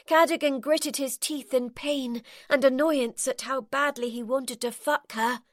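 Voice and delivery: ordinary delivery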